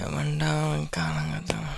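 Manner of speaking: slow, hushed, half-voiced grumble; sleepy grumble. Roommate disturbed early in the morning, sleepy grumble. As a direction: grumble softly as if barely awake.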